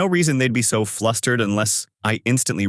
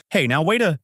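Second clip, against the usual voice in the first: higher, mildly alarmed protest; worried; 7 semitones higher than usual. A narrator's reading. Manager protesting the tax investigation, worried. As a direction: try to halt him, worried. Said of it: "he says worried".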